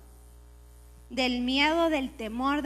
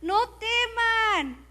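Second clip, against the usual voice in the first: higher, earnest call not to fear; preacher urging the people not to be afraid, higher and slower at the end; reassuring exhortation; 8 semitones higher than usual. Retold or imitated. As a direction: lift them out of their fear. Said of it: "yo le digo a la gente".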